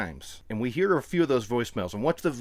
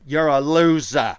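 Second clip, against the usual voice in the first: put-on slow, loud Boston accent insult; drawn-out Boston accent jeer; 7 dB louder, slower than usual. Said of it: "in a very thick Boston accent".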